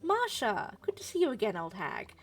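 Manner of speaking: faking pleasure at seeing someone you dislike; fake friendliness. [name] greets a disliked old acquaintance, fake friendly. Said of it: "Let's see if I can fake it".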